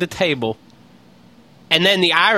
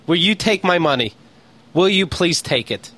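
normal talking voice

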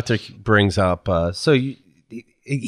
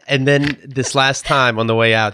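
normal talking voice

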